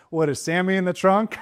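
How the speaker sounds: laughing guess. Retold or imitated. Daughter guessing the joke, laughing. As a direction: tease out the guess through a laugh. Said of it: "one of them actually says".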